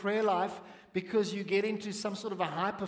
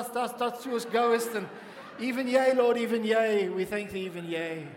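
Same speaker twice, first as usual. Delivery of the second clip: hammed-up King James prayer voice, louder; mock tremolo prayer; 2 semitones higher, 5 dB louder than usual. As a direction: parody old-fashioned pious praying. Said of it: "where did the tremolo come from".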